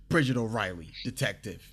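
lightly acted detective pulling rank; flexing authority. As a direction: announce name and title to pull rank. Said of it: "Bridget flexes".

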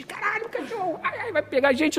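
hammed-up retold fright; mock panic. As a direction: squeal as if the dog will get you. Retelling their panic at barking dog.

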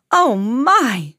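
drawn-out storybook dismay; mildly hammed-up shock; dismayed cry. A narrator's reading. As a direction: exclaim it as if finding a disaster. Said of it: "he cried".

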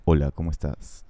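caress the listener's ears; seductive deep voice. Pretend. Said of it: "te voy a acariciar los oídos".

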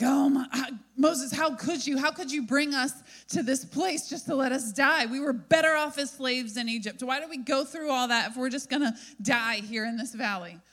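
high, loud, frantic complaining to Moses; panicked outcry. Retold or imitated. The frightened people accuse Moses, frantic and loud. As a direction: cry out in panic at the dead end. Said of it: "see the people start to flip out".

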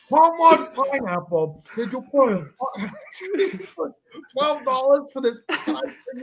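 high, loud rant breaking into laughter; mad dad. Retold or imitated. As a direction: imitate a father outraged at the price. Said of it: "why is he so mad".

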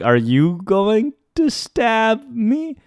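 high, very slow incredulous question; acted-out wary question, rising at the end; nervous disbelief. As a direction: stretch each word upward in mock dread. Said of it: "I began to laugh".

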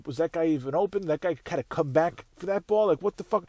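ordinary talk

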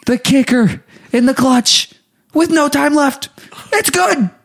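high excited announcer call of the winning kick; hammed-up game-winning kick call; mock play-by-play call.

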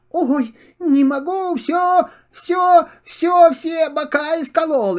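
high, rising cries of pain; pained wail. Acted. Kitten jumps up pricked by hedgehog quills, wailing. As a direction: whimper it as the prickles stab.

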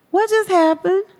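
high, bewildered question; high-voiced stunned puzzlement; retold confusion. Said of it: "I'm just really confused".

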